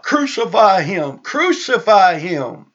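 imitated crowd shout, second cry drawn out. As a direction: cry it out like a mob. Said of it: "they're crying out".

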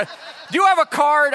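put-on high, slow kid voice asking; eager kid. A kid asking the pastor for a card, high and eager. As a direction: ask sweetly like a hopeful kid.

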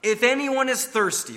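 emphatic high call; crying out. Acted. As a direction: cry it out to the whole crowd. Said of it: "and he cries out".

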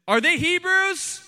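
high-pitched, drawn-out question; rhetorical challenge. Acted. Preacher voicing Paul's challenge to rivals, high challenging question. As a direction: throw out the challenge high and slowly.

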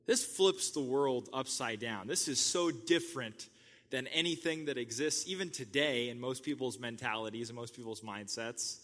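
ordinary delivery

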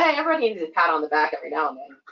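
usual speaking voice

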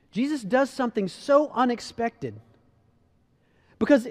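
ordinary talk, nothing marked

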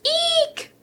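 very high squeal of fright; frightened shriek. A narrator's reading. Elephant seeing a real mouse, high frightened squeal. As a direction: shriek as if a mouse ran past you. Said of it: "shouted Ronald".

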